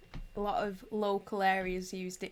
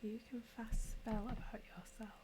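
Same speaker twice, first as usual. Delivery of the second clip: hushed, half-whispered readback; under her breath; 3 semitones higher, 8 dB quieter than usual. Real, not acted. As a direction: read it softly to yourself, puzzling it out. Rereading a puzzling message under breath.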